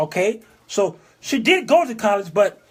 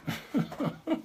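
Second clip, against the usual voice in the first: soft, breathless chuckling; wheezy laugh; 5 semitones higher, 13 dB quieter than usual. Real, not acted. Laughs after retold remark.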